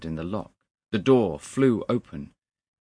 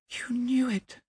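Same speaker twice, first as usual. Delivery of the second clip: high, whispery disbelief; aghast whisper. A narrator's reading. Young man repeats his accusation to the clerk, hushed and aghast. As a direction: breathe the accusation in shock.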